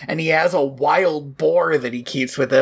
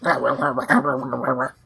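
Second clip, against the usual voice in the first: low mock grumble, words mashed together; half-hearted low gardener grumble; grumbling; 5 semitones lower, faster than usual. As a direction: grumble like a surly gardener brushing you off. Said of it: "he just grumbles".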